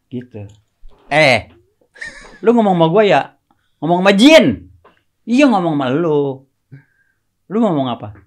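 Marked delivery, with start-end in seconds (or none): 1.1-8.1 mock comedy exchange. Acting out a dialogue with Bolot.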